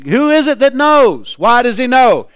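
ordinary delivery

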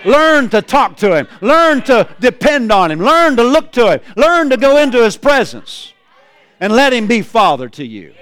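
full-voiced pulpit shout; preaching at full force.

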